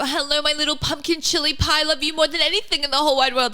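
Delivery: high, fast mock sweet-talk; mocking a mushy mum; mock doting mum. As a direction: gush like an overly doting mum. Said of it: "we don't talk like that".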